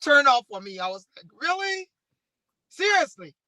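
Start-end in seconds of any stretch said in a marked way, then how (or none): 1.4-3.4 as if you can't believe your ears; a retold "really, seriously?"; incredulous disbelief.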